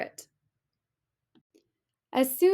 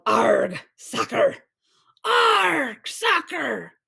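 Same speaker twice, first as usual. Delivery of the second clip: loud, higher pirate cheer; crew yelling; 4 semitones higher, 3 dB louder, much slower than usual. A narrator's reading. Pirate crew cheers at soccer, loud yell. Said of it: "the crew yelled".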